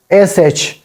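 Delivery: usual speaking voice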